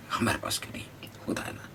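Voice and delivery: softly whispered envy; whispered aside. Acted. Teacher whispers the students' envy to them. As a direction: whisper it confidingly.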